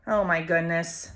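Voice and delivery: plain reading voice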